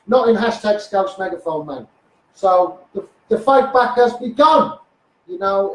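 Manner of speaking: ordinary talk, nothing marked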